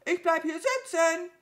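sehr hoher, lauter Krähenruf; trotziges Nachrufen. A narrator's reading. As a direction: aus der Ferne hinterherkrähen. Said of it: "rief die Krähe ihr nach". (laut und trotzig rufend)

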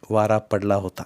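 ordinary delivery, nothing marked

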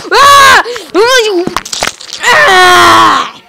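loud, high battle screams; full-voiced high yells; attack scream. Real, not acted. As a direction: scream as you strike. Attacking the enemy.

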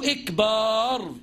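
إكبار with a long آ after the ب, said slowly; slow إكبار with the ب held long; wrong long vowel. Shown as a demonstration. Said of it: "يبقى مصيبة".

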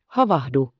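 put-on a child's bright shout of alarm; calling out. A narrator's reading. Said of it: "huusi Heidi".

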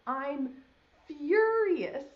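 high, loud, slow hammed-up fury; mock mad farmer. Showing a child how the farmer feels, hammed-up anger. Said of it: "like he's like".

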